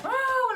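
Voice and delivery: high, loud drawn-out wail; mock-frantic high cry; frazzled cry. Telling viewers about her morning rush, frazzled cry.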